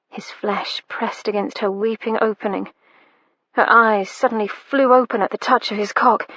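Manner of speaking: usual speaking voice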